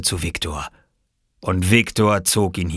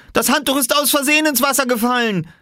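very high, raised excuse; yelling; 4 dB louder than usual. A narrator's reading. Small boy explaining the fallen towel, high pleading yell. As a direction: cry out a frantic child's excuse. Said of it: "schrie Oskar".

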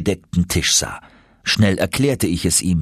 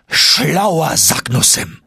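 much higher, louder, slow delighted exclamation; exclaiming; 8 semitones higher, 4 dB louder, much slower than usual. Acted. Professor grasping the secret, slow triumphant exclamation. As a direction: savour the name in triumph. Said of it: "rief er aus".

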